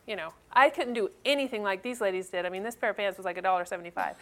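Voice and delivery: ordinary delivery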